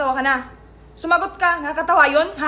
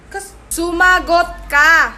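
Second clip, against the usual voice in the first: loud, very slow warning; mother repeats the order louder and slower; slow loud scolding; 7 dB louder, much slower than usual. Acted. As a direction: hammer each word of the order.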